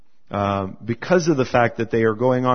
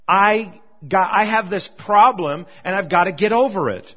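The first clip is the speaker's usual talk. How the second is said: preaching; 7 semitones higher than usual. Acted. Raised fervent preaching.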